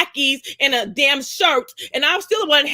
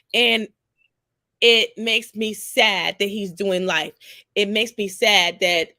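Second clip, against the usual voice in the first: normal talking voice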